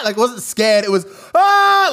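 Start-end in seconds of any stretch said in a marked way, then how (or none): 1.3-1.9 high loud mock scream; imitated old man's yell. Host imitating the neighbour's startled yell, high and loud. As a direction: yelp like he's falling off a cliff. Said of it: "Like you're falling off a cliff".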